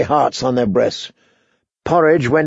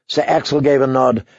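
ordinary delivery, nothing marked

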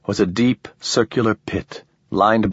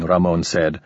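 plain reading voice

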